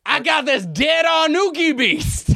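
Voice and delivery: high, drawn-out exclamation; hammed-up movie line.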